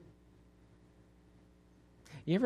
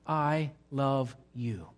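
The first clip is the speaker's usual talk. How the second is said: slow tender; 2 semitones lower, 3 dB quieter, much slower than usual. Acted. God's voice quoted slowly.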